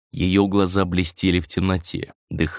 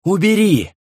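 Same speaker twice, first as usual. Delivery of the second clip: high, loud cry to stop it; urgent high-pitched yell; shouted demand; 11 semitones higher, 5 dB louder, slower than usual. A narrator's reading. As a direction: shout it out, recoiling from the light. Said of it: "крикнул он".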